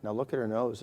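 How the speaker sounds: normal talking voice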